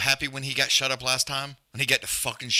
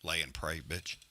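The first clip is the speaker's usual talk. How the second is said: low, soft mock Rogan dismissal; lightly voiced commentator scoffing; Rogan impression; 6 semitones lower, 8 dB quieter than usual. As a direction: drop low and brush it off. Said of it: "even Rogan's like".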